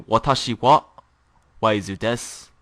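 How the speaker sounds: "Watashi wa", then a clear pause before "waizu desu"; pause after the particle. Shown as a demonstration. Teacher showing the pause after the particle, deliberate. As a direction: stop briefly after "wa". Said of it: "slight pause in between the particle and the next word".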